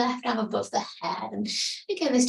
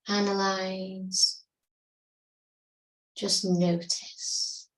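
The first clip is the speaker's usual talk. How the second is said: calm yoga teacher guiding breath awareness; guided meditation. Acted. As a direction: ease them into stillness, unhurried. Leads a seated meditation.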